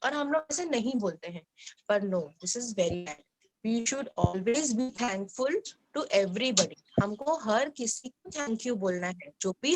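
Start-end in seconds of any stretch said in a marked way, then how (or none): 2.0-7.0 very slow, stressing each word; slow emphatic lesson. Shown as a demonstration. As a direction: spell it out slowly for the class. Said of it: "slow emphatic teaching line".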